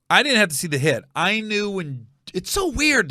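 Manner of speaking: normal talking voice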